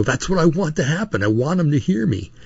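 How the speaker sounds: mildly acted, wanting to be overheard; defiantly outspoken. Husband answering his wife's shush, mildly defiant. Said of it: "I started to get a little loud".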